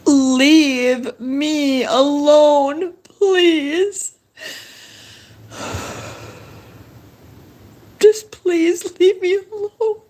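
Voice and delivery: pleading. Real, not acted. Begging unseen tormentors to stop.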